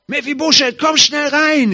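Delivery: high, loud urgent call; excited call from the door. Retold or imitated. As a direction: shout him into the house. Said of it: "riefen sie mich".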